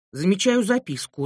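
plain reading voice

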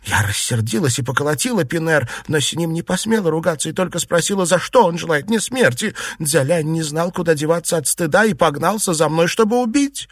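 high, hurried agitated account; upset wife's breathless-paced tale; agitated retelling. A narrator's reading. Frightened wife tells her story.

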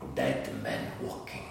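deep slow dramatic pronouncement; ominous announcer. Acted. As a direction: intone it like a doom-laden trailer. Ominous tagline voiced.

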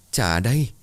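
put-on high, straining calls after the fleeing child; desperate pleading cries, pitched high; calling out desperately. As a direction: call after them with all your strength. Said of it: "ra sức rồi gọi lớn".

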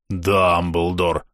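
low, drawn-out Дамблдор, as if throwing up; retching pronunciation. Acted. Gamer tries to say a hard nickname, retching pronunciation. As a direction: heave Дамблдор out slowly and deep. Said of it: "будто блюёшь".

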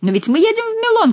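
high-pitched baffled protest; puzzled protest. A narrator's reading. Monk protests to Chicot, high and baffled. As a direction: raise the voice in disbelief.

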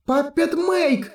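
very high, slow drawn-out call; high called spell. A narrator's reading. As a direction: sing out the spell in a high girl's voice. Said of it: "она продолжила".

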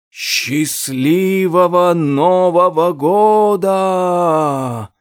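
slow, drawn-out rustling voice. A narrator's reading. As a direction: let it rustle out like dry leaves. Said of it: "прошелестел голос Саван де Саркофага".

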